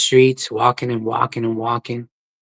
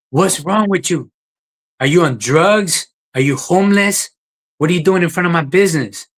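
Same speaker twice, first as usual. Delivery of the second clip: angry shout; 5 semitones higher than usual. Retold or imitated. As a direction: yell it at someone blocking your shop. Owner yelling at homeless man.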